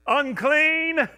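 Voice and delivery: high, loud warning cry; calling out. Retold or imitated. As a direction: cry it out so people step aside. Repeating the leper's cry.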